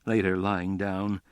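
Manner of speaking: plain reading voice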